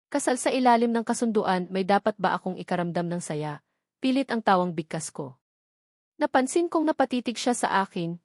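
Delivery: usual speaking voice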